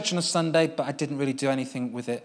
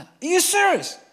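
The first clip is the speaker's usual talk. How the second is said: high, louder disbelieving question; pitched-up are you serious; incredulous; 6 dB louder than usual. Retold or imitated. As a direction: press each boy, hardly believing it. Questioning boys one by one.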